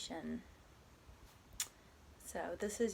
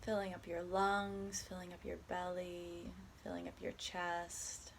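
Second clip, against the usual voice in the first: calm, unhurried body scan guidance with long pauses; guided meditation; 4 dB quieter than usual. Acted. Guiding a listener through a body scan, soft meditation voice. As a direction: ease the listener gently from one body part to the next.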